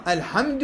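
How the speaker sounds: "الحمد" as al-hamdu, l audible; lam pronounced clearly. Shown as a demonstration. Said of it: "هاي لازم تظهر اللام".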